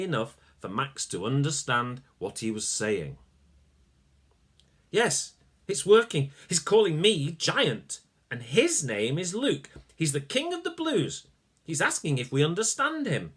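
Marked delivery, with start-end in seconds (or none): 4.9-13.3 high, louder thrilled reporting; excited boy. Acted. Max telling Sasha what the tiny king says, thrilled. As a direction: bubble over as it works.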